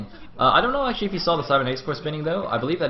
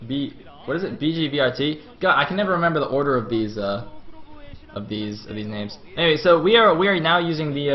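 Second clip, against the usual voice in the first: usual speaking voice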